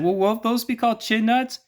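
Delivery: high falsetto woman in a joke; woman's voice. Retold or imitated. Woman in the joke answering the man, high falsetto. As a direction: squeak it up as the woman. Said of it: "And she said".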